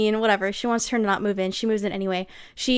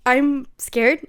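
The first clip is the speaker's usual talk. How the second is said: scared refusal; 4 semitones higher, 8 dB louder, much slower than usual. Acted. Addie refuses the boyfriend.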